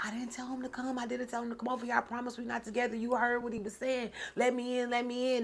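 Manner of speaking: high, soft, hurried pleading; retold defensive excuses. Woman assures boyfriend she didn't invite ex, high hurried defensiveness.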